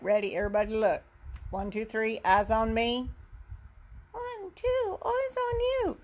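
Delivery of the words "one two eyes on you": high squeaky puppet; much higher, tiny puppet voice. Pretend. Puppet's answer to the call.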